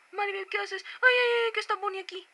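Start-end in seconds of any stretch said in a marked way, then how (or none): 1.0-1.5 high loud startled ay ay ay; startled cry. Real, not acted. Startled by Bonnie appearing. 1.5-2.3 high alarmed what is Bonnie doing here; startled question. Real, not acted. Startled question then repeated quieter.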